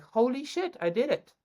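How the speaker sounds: high, astonished exclaim; mock amazed holy shit; amazed disbelief. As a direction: gasp it out, stunned it really happened. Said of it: "there was a moment I was like".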